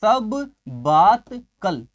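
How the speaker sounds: सब, बात, कल very slowly, one at a time; slow, broken up. Shown as a demonstration. Teacher showing where sabbatical comes from, सब बात कल slow and separated. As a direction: draw out each piece of "सब बात कल". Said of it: "ये देखो कहाँ से".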